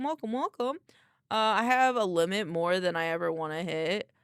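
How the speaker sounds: usual speaking voice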